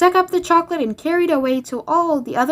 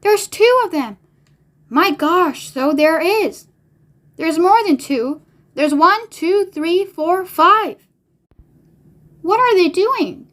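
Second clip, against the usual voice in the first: high, amazed exclamations; reader's controlled shouts of wonder; excited shouting; 6 semitones higher, slower than usual. A narrator's reading. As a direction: call out discoveries like an excited crowd. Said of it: "everybody started shouting at once".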